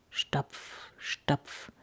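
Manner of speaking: hushed, voiceless stomping word; soft, spaced-out steps creeping nearer; distant footsteps. A narrator's reading. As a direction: murmur each step low in suspense. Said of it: "Es kam näher".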